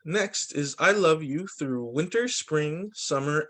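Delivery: ordinary talk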